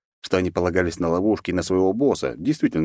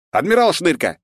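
calling out; 10 semitones higher, 5 dB louder than usual. Acted. Narrator calls rat admiral.

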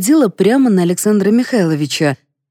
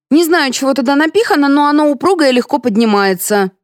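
maid voice; 7 semitones higher than usual. A narrator's reading. The maid speaks.

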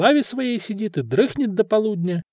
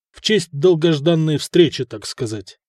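plain reading voice

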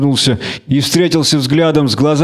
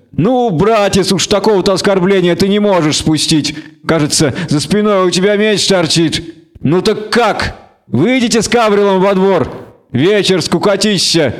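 higher-pitched, mock-coaxing provocation; needling tavern tough; goading gang leader; 6 semitones higher than usual. A narrator's reading. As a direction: push the stranger into a duel.

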